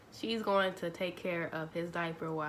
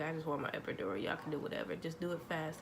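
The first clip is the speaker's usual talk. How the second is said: fading, tired mutter of pain; exhausted softer plea for the epidural; softer, worn-out plea; 3 semitones lower, 9 dB quieter than usual. Retold or imitated. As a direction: say it drained and much softer.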